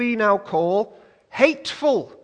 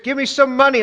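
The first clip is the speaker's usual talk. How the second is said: higher, louder mock command; commanding Jesus; 5 semitones higher, 5 dB louder, faster than usual. Preacher voicing Jesus ordering the fish, mock command.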